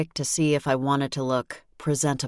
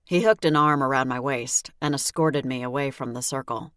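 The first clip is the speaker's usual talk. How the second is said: ordinary delivery, nothing marked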